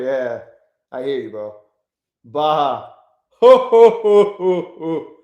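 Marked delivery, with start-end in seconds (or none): 3.4-4.7 loud booming ho ho, hammed up; mock Santa laugh. Streamer mocking chat with a loud mock Santa laugh. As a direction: boom out a jolly fake laugh.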